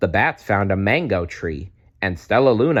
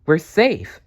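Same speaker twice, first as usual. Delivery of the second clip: relieved; 8 semitones higher, 5 dB louder than usual. Acted. Relief after rescue.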